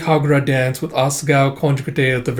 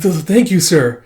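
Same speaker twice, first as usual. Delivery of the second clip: nervous stammer; 5 semitones higher, 5 dB louder than usual. Acted. Asuka bowing deeply to master.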